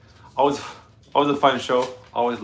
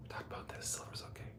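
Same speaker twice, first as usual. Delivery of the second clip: soft consoling whisper; 24 dB quieter than usual. Real, not acted. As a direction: whisper gently. Aside to the dog.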